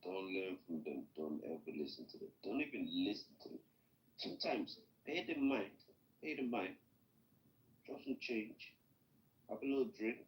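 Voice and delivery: muffled, as if through a wall. Real, not acted. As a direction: speak with the mic covered. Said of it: "sounds like you're very muffled".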